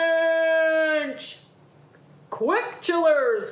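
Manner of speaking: high, stretched warning shout; alarmed cry. Acted. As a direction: call out a sudden danger. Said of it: "cried Grandude".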